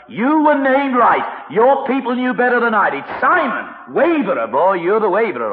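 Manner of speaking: loud, high telling-off; acted indignant reprimand; scolding. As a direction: tell him off at full voice, drawing out the words. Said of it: "what a telling off the Lord could have given him".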